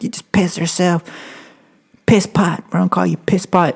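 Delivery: high, sneering mock instructor; imitated instructor taunt; taunting drill instructor. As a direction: jeer at the recruit like a bully. Instructors taunting crying recruit.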